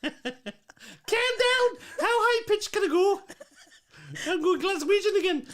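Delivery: very high pitched. Acted. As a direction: push the voice up as high as it goes. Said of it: "Why have you got that pitched?".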